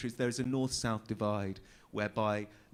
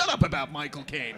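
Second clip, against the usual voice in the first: louder, higher comic rebuke; mock angry outburst; 4 semitones higher, 5 dB louder than usual. Producer silencing the actor about Caine, mock angry. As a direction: cut him off in mock exasperation. Producer snaps in acted quarrel.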